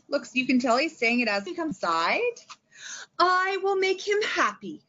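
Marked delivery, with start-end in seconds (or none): 3.2-4.8 high, slow, goofy character voice; silly happy voice. Shown as a demonstration. Teacher reading a picture book aloud to kids, goofy and happy. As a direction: ham up a cheerful storybook pig. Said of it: "you can be silly and goofy".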